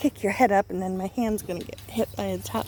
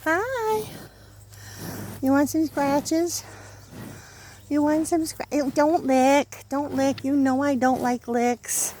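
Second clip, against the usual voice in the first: high, slow sweet voice for a pet; pet talk; 5 semitones higher, slower than usual. Real, not acted. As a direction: coo at a beloved animal. Talking to her cow.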